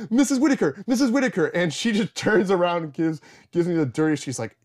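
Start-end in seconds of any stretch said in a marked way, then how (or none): 0.1-1.5 high, loud repeated call; calling out loudly. Retold or imitated. Student calling out to teacher again, loud and high. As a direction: shout her name to catch her. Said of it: "I was like".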